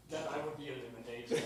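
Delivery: usual speaking voice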